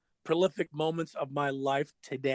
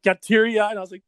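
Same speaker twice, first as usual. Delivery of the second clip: usual speaking voice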